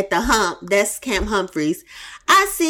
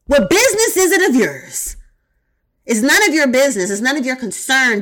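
higher, preacherly Farrakhan impression; 4 semitones higher than usual. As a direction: sermonize it like a stern minister. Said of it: "In my Farrakhan voice".

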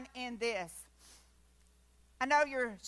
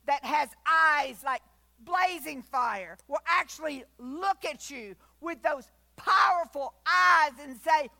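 louder toward a shouted well done; building proclamation; 2 semitones higher, 6 dB louder, slower than usual. Acted. Preacher proclaiming God's praise to the faithful, building shout. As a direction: build to a triumphant cry.

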